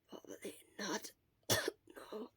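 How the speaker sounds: breathy sick whisper, barely there; fading words of someone feverish; weak whisper. Acted.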